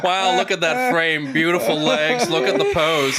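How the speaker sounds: gushing photographer praise, laughing; mock gushing admiration. Husband teasing wife getting dressed, gushing praise. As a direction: hype her up like a fashion shoot. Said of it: "It's like".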